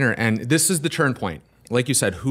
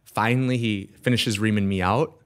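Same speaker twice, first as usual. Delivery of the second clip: ordinary talk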